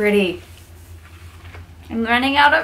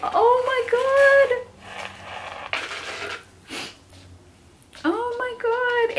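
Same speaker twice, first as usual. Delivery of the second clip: awed gasp; 11 semitones higher, 6 dB quieter, slower than usual. Real, not acted. Awed by gifts.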